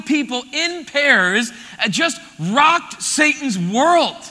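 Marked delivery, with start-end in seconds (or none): none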